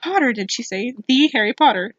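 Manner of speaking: ordinary delivery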